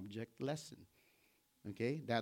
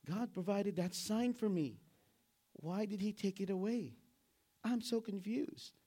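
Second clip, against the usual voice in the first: confused believer; 6 semitones higher than usual. Retold or imitated. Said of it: "some of us here would think".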